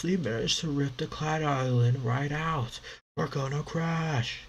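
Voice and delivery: high, pleading sailor; begging wife for forgiveness; groveling husband voice. Acted. Pirates begging wives forgiveness.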